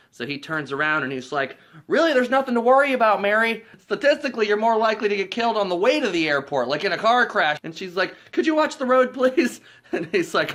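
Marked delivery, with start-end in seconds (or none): 1.9-7.6 high, loud, pushy chatter; imitated overeager reassurance. Lloyd turns round to reassure Mary. 8.3-9.6 high, loud mock alarm; imitated worried passenger. Worried passenger interrupts driver.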